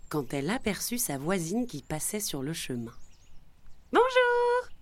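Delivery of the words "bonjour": high, loud, held-out bonjour; cheerful exclamation. A narrator's reading. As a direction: call out a greeting in a high-pitched voice. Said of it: "s'exclama celle-ci".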